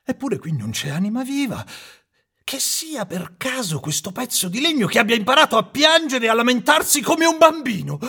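high, shaky frightened carpenter; old carpenter scared, turning angry; trembling and stammering. Acted. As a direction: stammer at first, then work up to bluster. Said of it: "tremando e balbettando dallo spavento".

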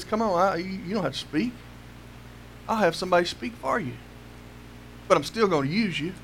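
God softly comforting a reluctant Moses; gentle reassurance. Acted. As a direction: reassure him quietly, gently.

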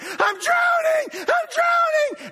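high, slow, shrieking cry of drowning; mimicking his wife's terrified screams; panicked scream. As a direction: scream it like you're going under. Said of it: "she screamed and she went".

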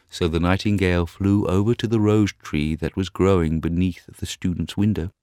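ordinary delivery

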